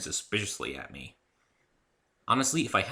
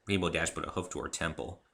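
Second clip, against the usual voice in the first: ordinary delivery, nothing marked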